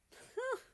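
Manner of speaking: short high laugh; high giggle. Acted. Canada laughs at America, a high laugh. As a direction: burst out laughing.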